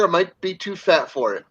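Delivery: ordinary delivery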